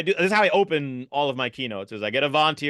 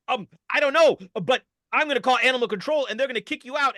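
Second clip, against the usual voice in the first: agitated man; 9 semitones higher than usual. Acted. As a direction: threaten in a rising panic. Man threatens to call animal control.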